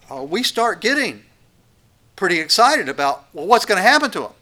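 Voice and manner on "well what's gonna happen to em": higher, louder worried cry; anxious parent. Acted. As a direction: fret out loud to God. Parents worrying to God.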